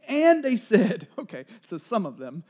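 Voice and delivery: ordinary talk, nothing marked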